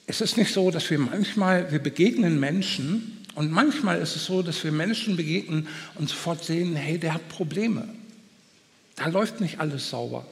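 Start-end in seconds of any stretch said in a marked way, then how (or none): none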